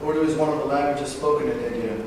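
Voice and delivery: usual speaking voice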